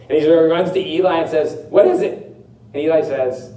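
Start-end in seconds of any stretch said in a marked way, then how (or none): 1.7-2.1 high-pitched questioning call; lightly acted boy answering a call; eager young Samuel. Samuel runs to Eli thinking he called, eager questioning.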